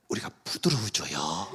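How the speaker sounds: soft unvoiced whisper. Shown as a demonstration. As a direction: breathe it out gently. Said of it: "성령의 기름".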